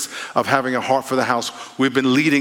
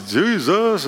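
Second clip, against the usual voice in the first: "Jesus" lifted higher, falling off; answering shouts from passing cars, higher; 6 semitones higher than usual. Retold or imitated. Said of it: "I'll just say".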